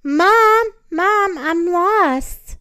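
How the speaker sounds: high, drawn-out wail; lost child calling. A narrator's reading. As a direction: call for Mom, stretched out slowly. Lost Victor calls for Mom.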